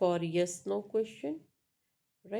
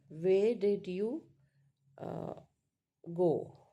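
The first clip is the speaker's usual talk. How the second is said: slow, emphatic question falling on GO; slower than usual. Shown as a demonstration. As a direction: spread out "Where did you", then drop on "go". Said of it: "falling tone. An example".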